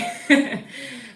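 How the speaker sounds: short burst of laughter; bright laugh. Real, not acted. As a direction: laugh it off lightly. Laughs at own joke.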